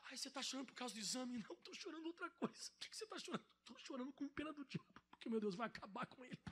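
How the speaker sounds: very soft, almost whispered exchange; hushed acted dialogue. A believer quietly explaining her tears, hushed and soft. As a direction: drop to a hush, like a secret.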